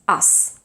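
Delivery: "Us" breathed out with a long voiceless S; whispered voiceless us. Shown as a demonstration. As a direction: hiss the S of "Us" unvoiced. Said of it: "has to stay voiceless".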